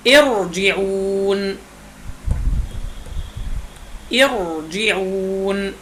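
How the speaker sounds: ارجعون drawn out very slowly with a thick, heavy ra; heavy ra recitation. Shown as a demonstration. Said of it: "مثل". (ارجعون بترتيل بطيء جدا وراء مفخمة)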